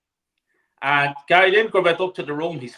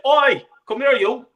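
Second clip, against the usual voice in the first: louder, higher call to get his attention; mock call out; 6 semitones higher, 6 dB louder than usual. Arsenal fan hailing Martin Keown outside the chipper, loud mock call. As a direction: hail a stranger over, raised and high. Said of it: "and said".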